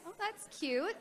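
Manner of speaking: ordinary talk, nothing marked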